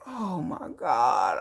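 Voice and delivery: slow, soft, low groan of boredom; bored exasperation. Retold or imitated. Reacting to young people's videos, slow low weary sigh. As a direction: drag it out wearily. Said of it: "I'm just like".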